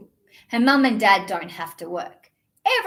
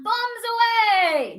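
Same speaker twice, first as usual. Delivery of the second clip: gleeful shout; 10 semitones higher, much slower than usual. Acted. Kid shouting at pool.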